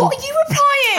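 high, loud excited question; amused excited squeal. Real, not acted.

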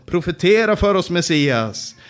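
loud, jeering call at the prisoner; mocking taunt. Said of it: "slog honom i ansiktet och sa".

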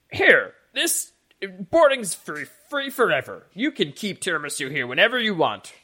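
hammed-up generous stable man, high-pitched; manic, grateful stable man. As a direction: press a gift on them, overexcited. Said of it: "And he goes".

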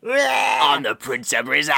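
slow, yelled Australian echo; Australian accent. Retold or imitated. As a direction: shout it back as an Aussie. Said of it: "An Australian accent".

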